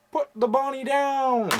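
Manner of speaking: high, slow, off-key Cage drawl; Nicolas Cage impression. Vlogger doing a self-confessed terrible Nicolas Cage impression. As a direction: do a bad Cage. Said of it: "terrible Nicolas Cage impression".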